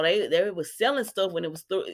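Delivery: ordinary talk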